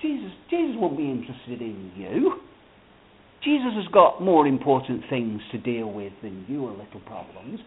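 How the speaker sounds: lightly voiced tempter, low and hushed; discouraging Satan. Satan discouraging a praying believer, quietly dismissive. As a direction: quietly talk them out of praying. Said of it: "He'll say".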